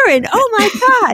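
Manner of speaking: high, laughing squeal of surprise; thrilled to bump into her; excited greeting. Retold or imitated. Running into therapist on street.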